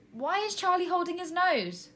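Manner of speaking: high, surprised cry; cried out. A narrator's reading. Tina at the binoculars, crying out. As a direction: blurt it out in surprise. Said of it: "cried Tina".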